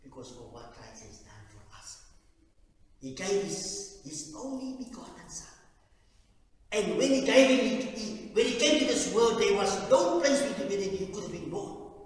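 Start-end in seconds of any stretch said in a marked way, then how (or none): 3.0-12.0 high, loud fervent preaching; preaching at full force. Acted. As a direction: proclaim it to the whole church, loud and high.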